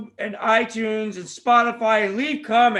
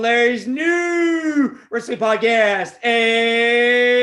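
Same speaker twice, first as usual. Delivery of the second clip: announcer shout; 3 semitones higher, 7 dB louder, much slower than usual. Acted. Announcing the show's name.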